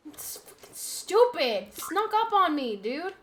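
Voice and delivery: high, slow, furious yell at the ambush; angry outburst. Real, not acted. Gamer snuck up on by a dinosaur, furious yell. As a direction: spit it out, outraged at being jumped.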